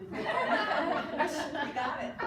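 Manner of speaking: laughing. Real, not acted. Laughing at the sitter's echo.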